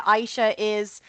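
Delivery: normal talking voice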